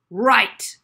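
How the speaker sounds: loud abrupt "Right"; sudden announcement. Acted. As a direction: snap it out to command attention. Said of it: "he suddenly announced".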